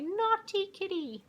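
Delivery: high, slow chirpy bird scolding. Acted. Birds scolding the kitty from the tree, in high bird voices. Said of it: "they say".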